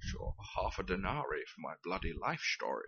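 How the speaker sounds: underplayed Connery accent, not outraged; calm Sean Connery. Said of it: "it's the tone of voice. Sean Connery doesn't do outraged".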